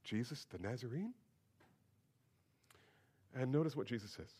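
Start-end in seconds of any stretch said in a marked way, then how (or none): 0.0-1.1 quiet, sheepish mumble of the name; soldiers' sheepish reply, said softly; sheepishly. Acted. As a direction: say it like caught-out soldiers, softer. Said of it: "sheepishly said".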